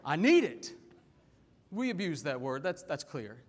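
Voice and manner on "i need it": loud, lightly acted demand; mock demanding kid. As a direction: briefly mimic an insistent teenager. Repeats mimicked demand for phone.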